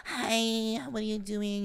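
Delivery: high-pitched mock flirty greeting; imitating friend on FaceTime. Host mimics friend FaceTiming a cute TikToker, high mock flirty voice. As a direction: put on a high voice for a call with a crush. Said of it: "to see that switch was so sickening".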